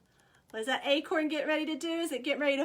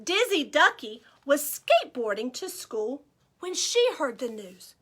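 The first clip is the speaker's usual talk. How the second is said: ordinary delivery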